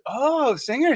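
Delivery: high, soft, drawn-out "oh" of wonder; mock impressed. Waitress hearing he is a famous singer, impressed.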